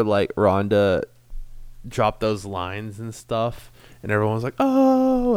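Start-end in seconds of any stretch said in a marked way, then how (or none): 4.6-5.4 high, startled oh; shocked gasp. Retold or imitated. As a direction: gasp like the crowd was stunned. Crowd reacting in shock.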